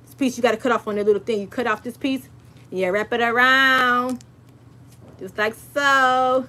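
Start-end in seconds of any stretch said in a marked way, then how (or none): 2.7-6.5 slow and deliberate; walk them through it slowly; slow demonstration. Shown as a demonstration. Slow demo of craft step.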